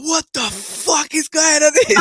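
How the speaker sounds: high, slow, laughing disbelief; shocked outburst. Retold or imitated. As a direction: act out the stunned newcomer, laughing. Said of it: "he was like".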